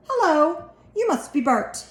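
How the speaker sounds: put-on high, bouncy puppet voice from a little boy; a small boy putting on a puppet voice; Sesame Street voice. A narrator's reading. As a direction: play a TV puppet greeting a friend. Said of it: "in his best Sesame Street voice".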